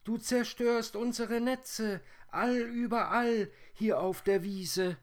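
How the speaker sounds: piercingly high, loud spider chorus; loud plaintive squeal, far above natural pitch; high whirring voices. A narrator's reading. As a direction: cry out as tiny complaining creatures. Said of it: "riefen sie im Chor".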